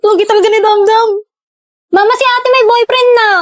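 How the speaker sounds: high, loud sing-song tattling; teasing tattle. Acted. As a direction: call out to tell mom on big sister. Teasing sister tells on sibling.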